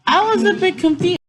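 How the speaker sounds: put-on high, loud puzzled kid voice; confused child. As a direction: sound baffled and young.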